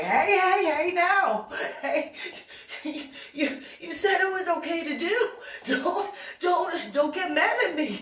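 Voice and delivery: high, loud, laughing mock panic, pitch way up; frantic high-pitched backpedal; mock panicked pleading. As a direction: beg her not to get mad, half laughing. Partner panics after being hit.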